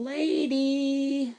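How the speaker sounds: very high, long drawn-out word; exaggerated, slow, high-pitched backpedal; mock-sweet correction. Real, not acted. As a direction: stretch it out in a silly falsetto. Jokey correction of a rude word.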